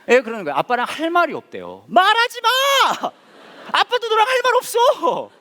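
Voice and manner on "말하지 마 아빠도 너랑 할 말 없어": high, louder hammed-up yell; mock shout. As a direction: shout it back like a fed-up dad. Mock father shouting back. (딸에게 소리치는 아빠 흉내)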